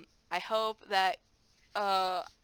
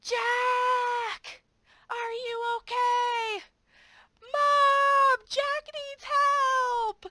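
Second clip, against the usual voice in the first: much higher, loud mock alarm, slowing at the end; hammed-up panic shout; 10 dB louder, much slower than usual. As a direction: cry for Mom as if a child just fell. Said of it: "I was like".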